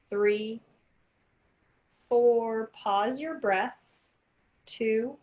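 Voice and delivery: slow, calm counting of breaths; guided breathing. Acted. Leads counted breathing exercise.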